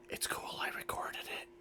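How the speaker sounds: hushed conspiratorial whisper. Acted. Chestrock secretly tells a friend it's recorded, whispering. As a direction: whisper it under your breath. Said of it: "Did I hear him whisper that?".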